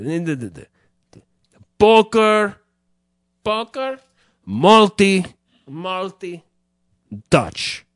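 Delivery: halting, punched fake-foreign words; hammy foreigner groping for words; fake Eastern European. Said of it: "He'd go".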